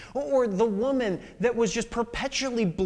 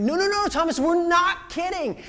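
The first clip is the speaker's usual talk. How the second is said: higher, louder, pressing; earnest insistence; 9 semitones higher, 4 dB louder than usual. Retold or imitated. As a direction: insist they're serious. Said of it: "They're like".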